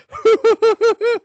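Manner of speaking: very high loud shrieking laugh; hammed-up drunk cackle; drunken shriek laugh. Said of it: "I'm shouting at the top of my voice".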